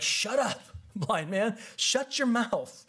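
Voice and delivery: hammed-up telling-off, pitched high; mock-angry shushing. Crowd silencing a blind man, mock-angry and high. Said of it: "'cause they were mad".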